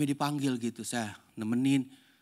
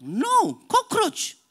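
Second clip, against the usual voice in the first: put-on stubborn child insisting; 9 dB louder, much slower than usual. Child arguing with grandpa.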